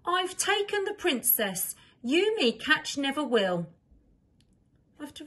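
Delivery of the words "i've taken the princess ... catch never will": high, louder sly kidnapper; mischievous taunt, pitched up; voiced note. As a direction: read the note as a taunting thief. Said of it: "a note that read".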